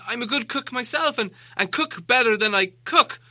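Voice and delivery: high, stammering hobbit begging; frightened pleading. Acted. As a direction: beg the trolls not to cook him.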